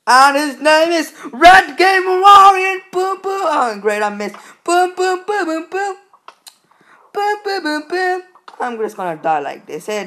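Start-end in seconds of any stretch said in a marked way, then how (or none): 0.1-2.8 slow, loud, higher announcer intro; hype announcer. Acted. Gamer introducing himself to viewers, hype announcer. Hyping up trick shot. 2.9-8.2 much higher booms; mouth boom effects. Acted. Gamer shooting arrows, high mouth-made booms.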